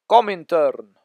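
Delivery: loud mock Russian accent. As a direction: announce it in a hammed-up Russian accent. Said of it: "That's my Russian accent".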